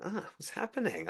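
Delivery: puzzled, gently asking the dog; confused. Retold or imitated. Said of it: "'cause I was confused".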